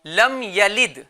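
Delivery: slow, measured tajweed recitation; Quran recitation. Shown as a demonstration. Teacher models the verse for children, slow recitation. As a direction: recite slowly with drawn-out vowels.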